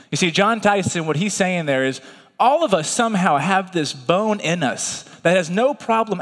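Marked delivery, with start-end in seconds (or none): none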